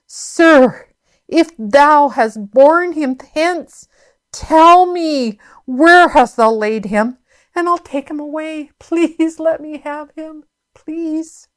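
high, drawn-out plea, softening at the end; pleading. Acted. Preacher as grieving Mary begging the gardener, pleading.

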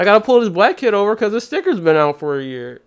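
high, whiny mock cop complaining; a whiny cop worried about quotas. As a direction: whine like a cop missing his bonus.

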